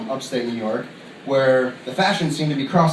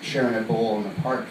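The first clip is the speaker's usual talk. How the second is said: ordinary talk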